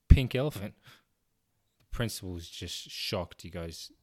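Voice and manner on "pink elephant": loud, blurted repeat; blurted shout. Acted. Boy repeating the phrase loudly to the principal.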